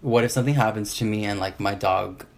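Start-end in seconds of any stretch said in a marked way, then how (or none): none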